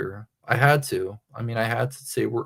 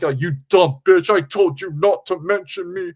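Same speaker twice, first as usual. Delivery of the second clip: higher, louder mock snarl; 5 semitones higher, 4 dB louder than usual. Streamer imitating JT threatening CB. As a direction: sneer it like a petty bully. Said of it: "He was like".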